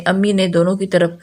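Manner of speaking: ordinary delivery, nothing marked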